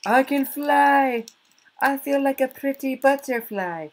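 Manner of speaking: high silly voice. Pretend. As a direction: float it up like a giddy butterfly. Silly flying butterfly voice.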